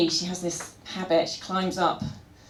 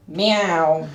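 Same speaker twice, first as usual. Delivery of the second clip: louder meow, wanting out; mock meow to go out; 2 semitones lower, 5 dB louder than usual. Shown as a demonstration. Owner imitating her cat wanting out, louder meow. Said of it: "it's a bit more".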